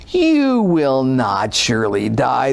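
slow, low, sly serpent line. Acted. The serpent tempting the woman, slow and low. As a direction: draw it out like a tempting snake. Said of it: "the serpent says to the woman".

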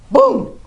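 high, loud comic boom; mock boom. Eve answering God, a high comic boom. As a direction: pop it out like a sound effect. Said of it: "And she goes".

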